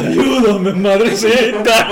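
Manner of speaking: slow, drawn-out mock cry for help; mock ugly yell. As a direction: holler it badly, stretching the last word. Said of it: "y luego gritaba bien gacho". (grito feo de burla)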